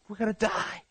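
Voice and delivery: high-pitched cry of despair; acted panic at a high pitch; panicked. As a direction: wail it high, without shouting. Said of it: "panicking, hollering, screaming, upset".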